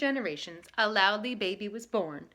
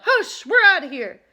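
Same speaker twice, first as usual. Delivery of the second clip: loud higher yell; catfish yelling; 7 semitones higher, 11 dB louder than usual. A narrator's reading. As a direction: yell as you flee. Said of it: "The catfish yelled".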